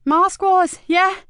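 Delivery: high, loud gleeful cheer; a boy thrilled and punching the air; excited kid. A narrator's reading. Mickey cheers to Marcus, high and loud with glee.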